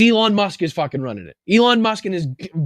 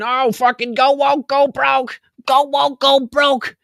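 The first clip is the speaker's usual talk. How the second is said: higher, sing-song taunting voice; mocking his tweets; 7 semitones higher, slower than usual. Host parroting Elon's old tweets in a mocking sing-song. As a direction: chant it like a smug schoolyard jeer. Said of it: "you saying".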